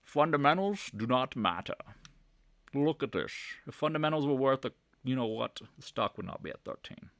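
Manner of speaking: hammed-up celebrity impression with a Scottish lilt; Sean Connery. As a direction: do a slurred Scottish accent, measured pace. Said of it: "Sean Connery says".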